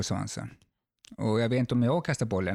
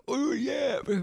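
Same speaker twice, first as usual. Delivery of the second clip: high mock yell of despair; retold scream; 12 semitones higher, slower than usual. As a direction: cry it out like a man cursing the sky from a cliff. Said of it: "bara skrek". (härmat skrik, högt och förtvivlat)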